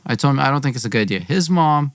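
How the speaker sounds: plain reading voice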